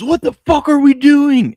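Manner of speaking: loud high slow furious yell; angry fan yell. Retold or imitated. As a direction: blow up at the TV in overtime. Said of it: "Cole jumps up and goes".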